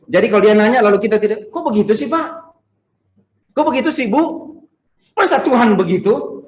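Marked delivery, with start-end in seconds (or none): 1.5-6.4 mock protest. Mimicking questioners protesting to him.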